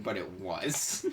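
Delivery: ordinary talk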